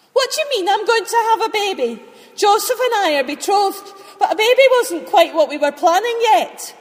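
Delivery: incredulous half-laughing outburst; astonished. Acted. Mary reacts to baby news.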